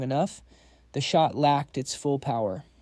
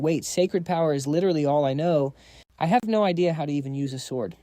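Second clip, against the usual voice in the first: ordinary delivery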